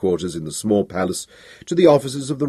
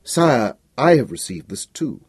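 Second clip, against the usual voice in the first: usual speaking voice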